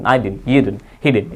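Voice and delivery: didn't said softly as a quick din; native clipped didn't. Shown as a demonstration. Teacher shows how native speakers say didn't, soft and clipped. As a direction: squash didn't into din, lightly. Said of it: "डिन pronounce करते हैं".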